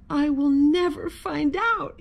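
high, mildly tearful lament; sniffling. A narrator's reading. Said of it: "he sniffled".

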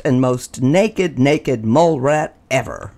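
ordinary delivery, nothing marked

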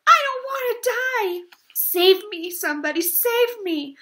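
high, loud terrified pleading; panicked screaming. Acted. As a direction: beg for your life, shrieking. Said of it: "screamed Wilbur".

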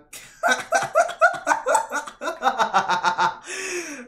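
long, high, helpless cackle that keeps rolling; high wheezing laugh. Real, not acted. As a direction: crack up completely, high-pitched and loud. Loses it at a game death.

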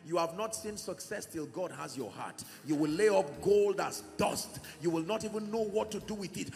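ordinary talk, nothing marked

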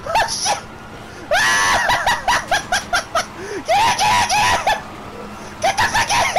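very high shrieks through laughter; hysterical screaming. Real, not acted. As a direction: scream for them to get in, half laughing. Screaming teammates into the car.

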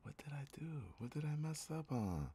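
soft, high, baffled questioning; bewildered hush. Real, not acted. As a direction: ask it quietly, in disbelief. Bewildered after sudden failure.

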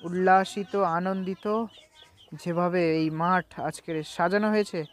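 ordinary delivery

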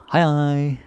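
hi, hi sung out high and loud; bright casual goodbye. Shown as a demonstration. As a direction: call out hi, hi cheerfully to a friend. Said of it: "casual goodbye demo".

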